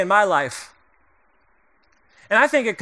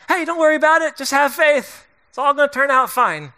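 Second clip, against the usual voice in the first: breezy, higher, mock-cheerful platitude; glib reassurance; 5 semitones higher than usual. Pastor mocking an empty comfort, breezy and glib. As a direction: toss off empty comfort lightly. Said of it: "what do you probably least want to hear".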